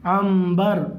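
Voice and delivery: عنبر as am-bar, lingering on the m; nun read as mim. Shown as a demonstration. Teacher repeats after the rule, عنبر said with a held m. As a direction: hum an m before the b in عنبر. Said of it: "نون کو میم سے بدل دیں گے".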